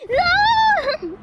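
high, loud wailed no; hammed-up high wail; mock horror shout. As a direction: cry out in playful horror.

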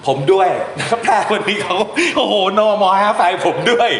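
real amusement breaking into laughter; amused laughing. Real, not acted. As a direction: talk while cracking up. Amused by the curt line.

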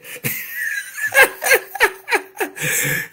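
high, loud burst of laughter; long, high belly laugh; hearty laugh. Real, not acted. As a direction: laugh hard at your own joke. Laughing at own joke.

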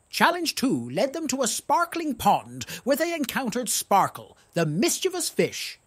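usual speaking voice

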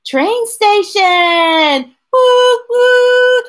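high, loud and slow, then a sung train whistle; a boy thrilled to go to the station; excited child. Acted. Storyteller voicing Charlie, calling out excitedly.

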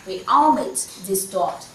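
ordinary talk, nothing marked

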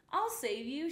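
high, loud heroic shout. A narrator's reading. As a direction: call it out while racing to the rescue. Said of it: "shouted Pete".

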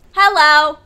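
loud, bright call of hello; sudden loud hello. Real, not acted. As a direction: shout a greeting across the room. Calling out to a Sim.

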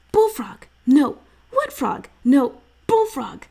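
high, loud eager guesses; excited boy guessing. A narrator's reading. Little brother guessing eyes shut, high and loud. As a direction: fire off guesses like a keen kid.

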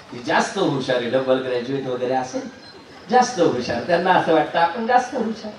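plain reading voice

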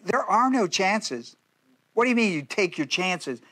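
ordinary talk, nothing marked

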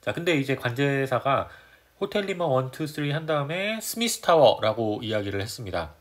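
ordinary talk

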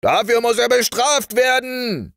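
high, loud rallying cry; high-pitched collective outcry; army shouting. Acted. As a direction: shout it like a whole army demanding punishment. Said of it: "schrie die ganze Armee".

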